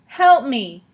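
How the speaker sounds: high, loud desperate call; yell. A narrator's reading. Olive yells to nobody at all from the boat. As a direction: call out loudly for rescue. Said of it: "she yelled".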